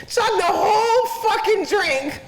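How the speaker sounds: high, slow words squeezed out through laughter; real giggle fit, barely getting it out; laughing hard. Real, not acted.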